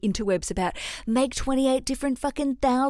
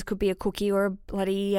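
normal talking voice

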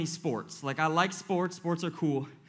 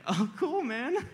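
amused pastor, laughing; 5 semitones higher than usual. Retold or imitated. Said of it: "He's like".

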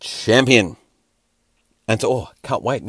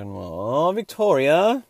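hammed-up wail climbing very high; mock wail; 7 semitones higher, much slower than usual. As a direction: break into a mock-tearful, soaring cry. Acts out fan's tearful tweet.

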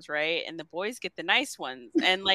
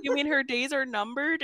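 higher-pitched incredulous question, retold; mock disbelief; 7 semitones higher than usual. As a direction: echo the news back as a question. Retold shocked question from Hazel.

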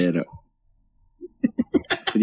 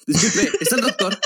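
mock voice. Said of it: "Eso fue como parte del chiste".

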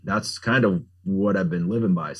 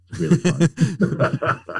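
drawn-out sarcastic high laugh; 10 semitones higher, 3 dB louder, much slower than usual. Acted. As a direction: say it slowly, clearly not meaning it. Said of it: "He said sarcastically".

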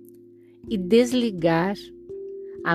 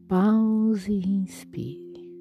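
soft spa whisper; 4 dB quieter, much slower than usual. Acted. Breathing cue, meditation.

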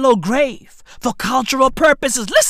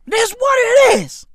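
much higher loud punchline; high shout; 11 semitones higher, 4 dB louder than usual. Acted. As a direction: bark it high.

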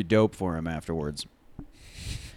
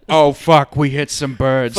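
louder, half-laughing mock pilot panic; pretending to be the pilot as the birds strike; mock pilot alarm; 2 semitones higher, 9 dB louder, slower than usual. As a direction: play the pilot swearing as the birds hit. Acting pilot hitting birds.

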